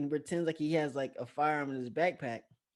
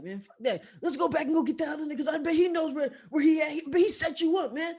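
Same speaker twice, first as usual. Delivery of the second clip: high, quick, agitated imitation; fired-up homeboy; 12 semitones higher, 3 dB louder than usual. Storyteller imitates homeboy demanding to go back, fired-up. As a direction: argue heatedly in a raised pitch. Fired-up friend urging revenge.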